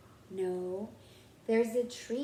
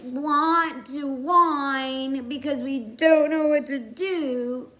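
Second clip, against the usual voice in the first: slow, drawn-out, louder mock whine; mock whining; 3 semitones higher, 7 dB louder, slower than usual. Teacher mimicking stuck young writers, mildly whining. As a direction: drag the words out in a light whine.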